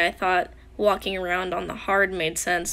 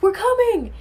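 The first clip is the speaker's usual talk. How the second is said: high, loud imitated holler; calling out; 11 semitones higher, 8 dB louder than usual. Rescue team calling to stranded friend, high shout. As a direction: shout reassurance from far off.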